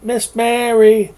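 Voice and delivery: loud, slow, high call across the house; little sister hollers to the helper for help; hollering for help. A narrator's reading. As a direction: call out to tattle on the boy. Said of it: "She hollered".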